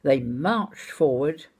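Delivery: normal talking voice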